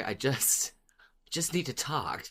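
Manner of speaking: ordinary talk, nothing marked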